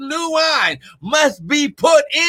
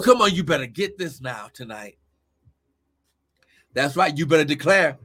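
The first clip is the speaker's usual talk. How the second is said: ordinary talk, nothing marked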